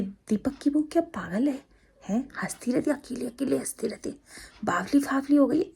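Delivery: hushed whisper, like neighbours gossiping; secretive whispered gossip about the young bride; gossipy whisper. Retold or imitated. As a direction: whisper it as a nosy neighbour would.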